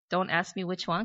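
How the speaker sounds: usual speaking voice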